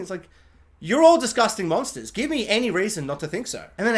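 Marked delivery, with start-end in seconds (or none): none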